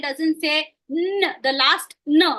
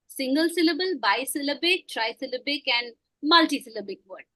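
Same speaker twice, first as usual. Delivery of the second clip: usual speaking voice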